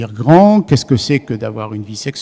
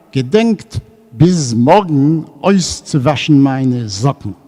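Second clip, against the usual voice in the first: slow, deliberate Yiddish read-aloud; Yiddish reading with his accent; 4 dB louder, slower than usual. Shown as a demonstration. Lecturer reading the Yiddish joke to the audience, slow and accented. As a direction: read it out in Yiddish, slowly, savouring it. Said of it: "C'est avec mon accent".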